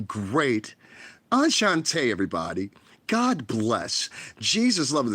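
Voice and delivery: lightly high, sugary fake-sweet blessing; a hint of a sweet little Tiny Tim; mock Tiny Tim voice. Vlogger mocking a fake-nice streamer as Tiny Tim, sugary. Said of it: "talking like Tiny Tim".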